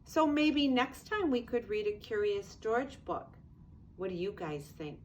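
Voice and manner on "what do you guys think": plain reading voice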